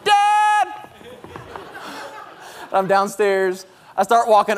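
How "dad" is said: put-on loud, high excited kid's shout; child calling out. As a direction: yell down the stairs for your father. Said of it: "I can hear the feet upstairs".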